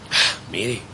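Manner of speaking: ordinary delivery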